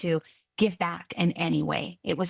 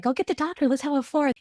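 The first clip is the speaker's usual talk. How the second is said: eager husband; 8 semitones higher, faster than usual. Retold or imitated. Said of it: "my husband's like".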